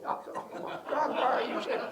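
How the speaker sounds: high-pitched, laughing horrified protest; retold aghast reaction; mock dismay. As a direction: exclaim in comic alarm, pitch way up. Acts out lawyer's alarmed reply.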